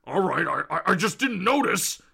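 ordinary delivery

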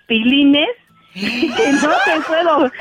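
slow, words broken up by giggles; laughing disbelief. Real, not acted. Caller confessing to the radio host what she saw, laughing. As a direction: laugh through the scandalous confession.

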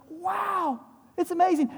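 high, mock-amazed wow; amazed crowd. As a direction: play the gushing, impressed crowd. Crowd voiced, amazed by miracle.

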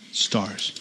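low, soft single word; hushed utterance. Retold or imitated. Preacher imitating God creating stars, hushed and low. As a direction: drop it quietly after a breath. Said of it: "He just said".

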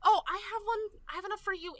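high, loud eager outburst; excited offer. Acted. Lavender offering a button to Danny, excited.

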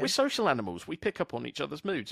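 ordinary talk, nothing marked

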